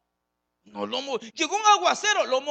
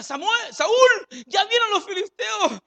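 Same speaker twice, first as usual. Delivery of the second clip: panicked cry; 11 semitones higher, 10 dB louder, slower than usual. Acted.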